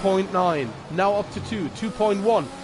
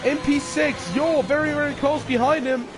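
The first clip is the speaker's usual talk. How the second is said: motorsport commentator; 9 semitones higher than usual. Real, not acted. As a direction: call the final lap at full energy. Final lap commentary, high energy.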